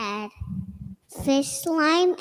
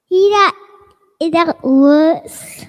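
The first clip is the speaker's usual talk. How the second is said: eager insistence; 4 semitones higher, 10 dB louder than usual. Real, not acted. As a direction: blurt it out, sure of yourself. Child insists story continues.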